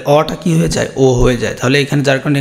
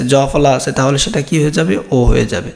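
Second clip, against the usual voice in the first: usual speaking voice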